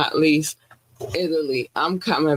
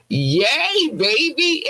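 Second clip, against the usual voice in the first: excited cheer; 8 semitones higher, much slower than usual. Real, not acted. Cheering in the Love Notes segment.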